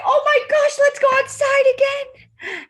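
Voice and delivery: high, loud burst of delight; excited quote. Acted. Children glad spring is here, high excited exclamation. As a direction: exclaim it like spring has just arrived. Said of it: "And we start to say".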